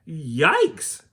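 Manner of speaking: high, loud shocked yelp. Acted. Kids stepping into the icy stream, loud shocked yelp. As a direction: yelp as if the water is freezing.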